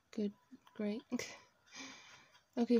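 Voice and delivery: ordinary talk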